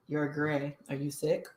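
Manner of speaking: teacher quietly mocked as bone-dry. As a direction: state it without any concern. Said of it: "Was that really his tone?".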